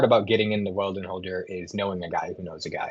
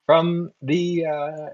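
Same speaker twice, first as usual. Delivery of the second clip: high-pitched merchant introducing the caravan; caravan trader, salesman's pitch; 6 semitones higher than usual. Acted. Trader offers goods to passing adventurers, in a high sales voice.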